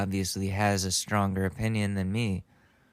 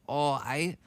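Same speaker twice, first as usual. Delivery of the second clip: put-on botched German accent; 4 semitones higher than usual. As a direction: attempt a German accent and miss badly.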